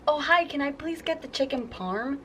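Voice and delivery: mimicking parents at a restaurant; mock parent voice. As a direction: imitate a fussy customer ordering sweetly. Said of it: "my parents will pull one of these".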